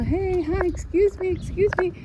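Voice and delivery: high, light imitation of a runner calling; mock runner's call. Runner calling out to me on the path, imitated high and light. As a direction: lightly call out like a passing jogger. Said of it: "this one runner was yelling".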